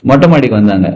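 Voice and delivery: usual speaking voice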